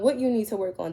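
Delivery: ordinary delivery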